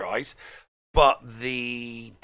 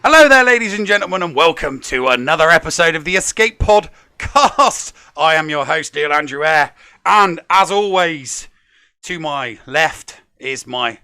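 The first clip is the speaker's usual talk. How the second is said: booming, fast show-host welcome; big over-the-top host opening; loud show host; 3 semitones higher, 10 dB louder than usual. Acted.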